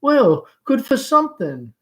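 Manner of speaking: rapturous Southern mother; rhapsodic. Acted. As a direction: swoon over the words. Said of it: "Her tone is rhapsodic".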